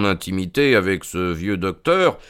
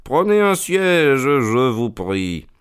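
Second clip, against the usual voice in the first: much higher, slow, syrupy politeness; honeyed tone; 5 semitones higher, slower than usual. A narrator's reading. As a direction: lay the false courtesy on thick. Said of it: "dit Holmes d'un ton mielleux".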